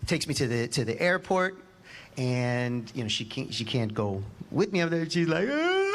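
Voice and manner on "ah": high wailing sob of a mother; mom's tearful cry. Retold or imitated. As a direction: burst into a high, tearful wail. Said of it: "She started crying".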